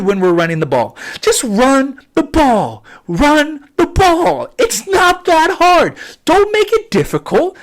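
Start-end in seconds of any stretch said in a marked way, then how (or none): 1.2-7.6 higher, louder and slow, pleading in exasperation; exasperated plea. Real, not acted. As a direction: beg them as if it were obvious.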